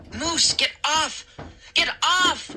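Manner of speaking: high desperate cry; wailing plea. A narrator's reading. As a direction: wail for him to get off. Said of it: "I wailed".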